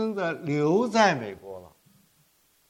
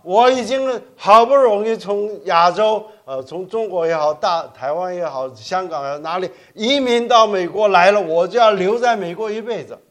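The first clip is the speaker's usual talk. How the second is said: stubborn resolve; 4 semitones higher, 9 dB louder than usual. Pretend. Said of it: "说".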